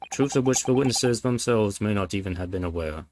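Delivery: usual speaking voice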